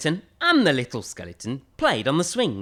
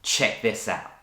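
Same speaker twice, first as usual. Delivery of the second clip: ordinary delivery, nothing marked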